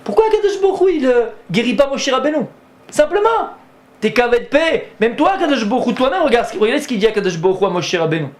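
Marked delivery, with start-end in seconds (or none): 0.0-6.6 excited rhetorical question. Real, not acted. Preacher's rising rhetorical question.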